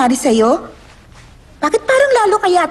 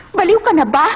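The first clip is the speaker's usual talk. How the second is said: ordinary delivery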